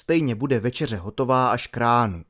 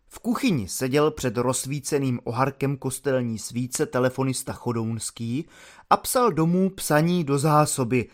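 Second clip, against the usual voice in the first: ordinary delivery, nothing marked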